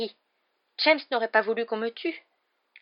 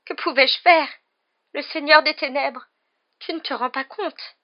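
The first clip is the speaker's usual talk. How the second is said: high, whining self-justification; whimpering; 7 semitones higher, 9 dB louder than usual. A narrator's reading. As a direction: make tearful excuses, begging to be understood. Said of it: "pleurnicha-t-il". (justification pleurnicharde)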